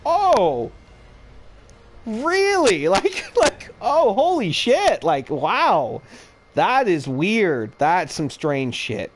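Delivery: high, hammed-up fake shock; mock surprise. Retelling confronting his ex about weed, hammed-up high mock surprise. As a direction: overplay the astonishment sarcastically.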